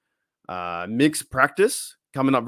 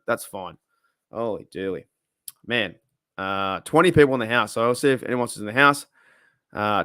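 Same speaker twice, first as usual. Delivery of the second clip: ordinary talk, nothing marked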